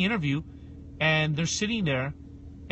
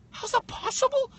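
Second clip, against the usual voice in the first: much higher, far mocking pitch; incredulous echo. Guest echoes the interviewer's question back in disbelief. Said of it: "incredulous mocking echo".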